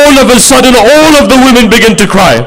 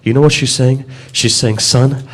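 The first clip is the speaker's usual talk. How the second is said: low, hushed grieving telling of the martyrdom; hushed mournful recitation; 10 dB quieter than usual. Acted. Preacher to mourners at majlis, hushed grieving lament.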